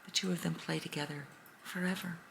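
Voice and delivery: plain reading voice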